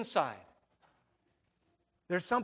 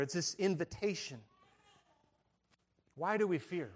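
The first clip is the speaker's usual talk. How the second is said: ordinary delivery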